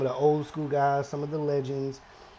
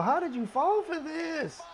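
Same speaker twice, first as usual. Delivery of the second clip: dismayed; 13 semitones higher, 4 dB quieter, slower than usual. Real, not acted. Gamer dismayed at his wrestler.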